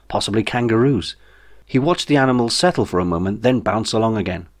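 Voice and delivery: ordinary delivery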